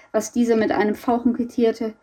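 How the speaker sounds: ordinary delivery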